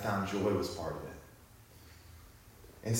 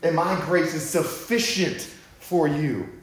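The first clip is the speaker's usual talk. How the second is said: higher, louder preaching, slowing on key lines; preaching at full force; 8 semitones higher, 7 dB louder than usual. Acted. Pastor urging the church to seek God's overflow, full-force preaching. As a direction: drive it home to the congregation.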